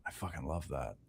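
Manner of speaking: very low, hushed relish; whispered admission. Retold or imitated. Admitting love of healthy competition, whispered relish. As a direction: confide it in a whisper. Savouring being challenged by a colleague.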